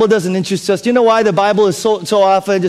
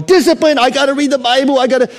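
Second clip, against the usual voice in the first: mock strained resolve; 8 semitones higher, 3 dB louder than usual. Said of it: "by going".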